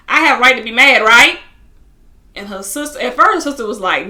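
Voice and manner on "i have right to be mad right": loud, higher-pitched indignant retelling; acting out an upset demand for agreement; indignant appeal. Upset woman venting to her sister, loud and indignant.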